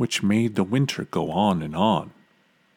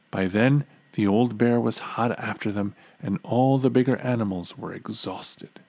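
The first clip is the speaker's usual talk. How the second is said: ordinary delivery, nothing marked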